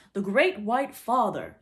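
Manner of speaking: loud, slow lordly proclamation. A narrator's reading. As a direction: pronounce it grandly, drawn out and loud. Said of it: "in a very lordly manner".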